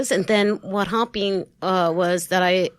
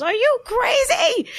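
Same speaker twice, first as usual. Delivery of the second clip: much higher, slow, squealed disbelief; shocked college buddy; 3 dB louder than usual. Retold or imitated. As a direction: play the friend diving for the card. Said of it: "She goes".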